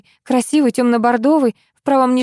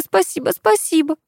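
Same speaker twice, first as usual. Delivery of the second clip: skipping record; 7 semitones higher than usual. Acted. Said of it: "словно заевшая пластинка".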